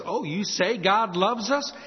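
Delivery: mocking, scornful questioning, slowed; a hint of a smug unbeliever; skeptical challenge. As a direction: throw the objection down like a dare. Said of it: "it sometimes goes like this".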